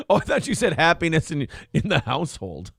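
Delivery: normal talking voice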